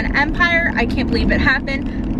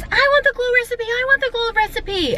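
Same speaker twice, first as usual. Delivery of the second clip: put-on much higher whiny kid voice, fast; mimicking a little girl; 13 semitones higher than usual. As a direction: squeak it out like a demanding eleven-year-old. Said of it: "she goes".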